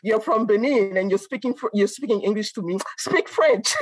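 mock French African accent, a little fast mid-line; playful French African accent, ending high. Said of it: "you know, with the accent".

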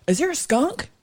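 high, suspicious dad voice; squeaky nosy parent asking; imitating dad. Dad sniffing out weed smoke.

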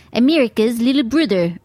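mock Australian accent, vowels twisted; bad Australian accent. Comic imitating the Australian bros' quote, bad Australian accent. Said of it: "That's not very good Australian accent".

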